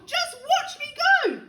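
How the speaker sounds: high, loud crowing holler; boastful call down. A narrator's reading. Grandma boasting to George below, boastful call. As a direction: crow it down from above. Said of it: "she called down to George".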